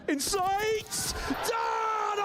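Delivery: high triumphant shout; triumphant yell. Real, not acted. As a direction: roar it as the round ends. Caster shouts the round end.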